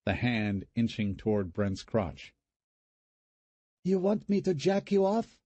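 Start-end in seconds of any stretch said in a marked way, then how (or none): 3.8-5.4 put-on high falsetto, Vietnamese-accented come-on; falsetto hooker voice. A narrator's reading. Brother teasing brother with a dummy arm, falsetto hooker voice. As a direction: tease him in a squeaky hooker voice. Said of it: "speaking in a falsetto Vietnamese hooker voice".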